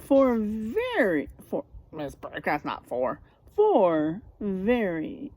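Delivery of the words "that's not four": ordinary delivery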